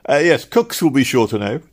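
relieved. A narrator's reading. Clerks sending the traveller to Cook's, relieved. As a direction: seize gladly on the easy answer. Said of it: "they said with relief".

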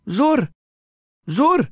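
put-on high, bright little boy's voice; a small child's voice, up high and firm; child calling out. A narrator's reading. Child calling to noon prayer.

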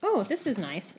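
ordinary delivery

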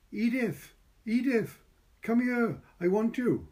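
high, loud call across the wall; calling over the wall. A narrator's reading. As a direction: servant girl calling her friend over. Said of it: "and called over the wall".

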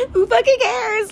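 exasperated. Real, not acted. Exasperated self-acceptance outburst.

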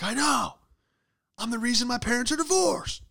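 hammed-up self-blaming outburst; angry at yourself. Worker outdoes his aggressive manager's anger, yelling at himself. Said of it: "Get angrier at yourself".

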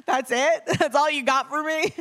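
loud, high mock incredulity; hammed-up flabbergasted protest; laughing disbelief. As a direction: laugh through an indignant question. Retold disbelief at director's short answer.

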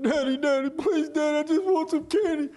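put-on high whiny kid's plea; high, pleading child voice, hammed up; begging child. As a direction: beg like a little boy wanting candy. Child begging dad in story.

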